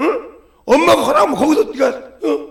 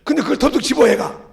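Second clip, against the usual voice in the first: ordinary talk, nothing marked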